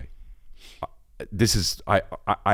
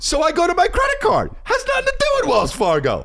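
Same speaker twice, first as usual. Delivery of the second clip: mounting outrage. Real, not acted. Rising frustration at declined card.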